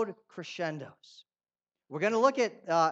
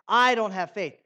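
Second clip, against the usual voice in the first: louder, pointed "I don't have faith."; emphatic quote; 3 semitones higher, 8 dB louder than usual. Retold or imitated. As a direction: say it as the thing to stop saying. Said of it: "in that sense".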